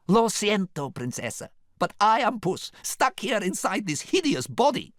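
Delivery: put-on high accented character voice; Puss. A narrator's reading. Puss explains himself to the princess, high and dramatic.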